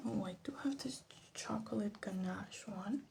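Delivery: ordinary delivery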